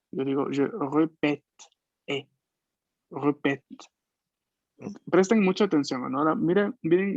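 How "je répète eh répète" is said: répète with the second E wide open, mouth open. Shown as a demonstration. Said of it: "la boca está abierta". (répète con la E abierta, boca abierta)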